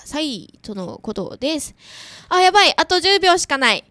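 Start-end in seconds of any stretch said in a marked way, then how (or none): 2.3-3.8 sudden, loud and high panic; startled. Real, not acted. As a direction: realize time is up. Runs out of airtime.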